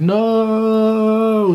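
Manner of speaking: long, high drawn-out wail; hammed-up slow-motion movie "no"; dramatic cry. Seeing a gun on his roommate, desperate cry.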